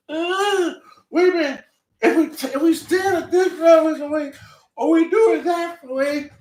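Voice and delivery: high, loud mocking voice; mock fussy girls. Mocking the two women struggling.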